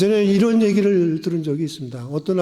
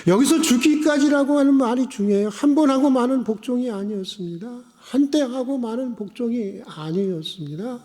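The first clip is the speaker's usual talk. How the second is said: raised, insistent pulpit voice; impassioned preaching; 7 semitones higher than usual. Acted. Preacher insisting obedience lasts until death, impassioned preaching. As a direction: hammer the point into the congregation.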